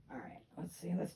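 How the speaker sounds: soft whisper to herself. Real, not acted. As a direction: whisper it under your breath. Muttering to herself while choosing.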